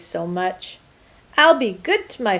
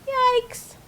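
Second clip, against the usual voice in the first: yikes. Retold or imitated. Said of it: "and he's saying".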